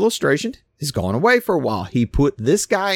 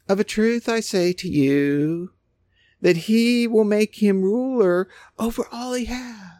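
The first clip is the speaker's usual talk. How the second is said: effeminate Jesus; 9 semitones higher than usual. Shown as a demonstration. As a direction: read it touchy-feely and gentle. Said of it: "they would almost put this, like, effeminate".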